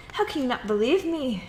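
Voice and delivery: high, loud, exasperated pleading; indignant loud appeal; loud plea. Acted. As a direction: demand to be believed. Woman pleading with doubting captain.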